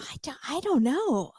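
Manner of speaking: high, breath-only mock confusion; whispered bewilderment. Co-host answering host's question, whispered bewilderment. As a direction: whisper it back, at a loss. Co-host joins the hushed puzzling.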